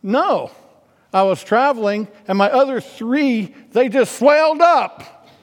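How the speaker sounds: sarcastic Southern comeback climbing to a loud punchline; Bill Engvall. Retold or imitated. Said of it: "He's got a real Southern accent".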